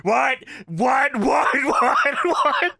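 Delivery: imitated crowd chant, high pitched; mock chanting, voice climbing; crowd going crazy. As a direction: fire off repeated questions like a rowdy arena. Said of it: "They start going crazy on the".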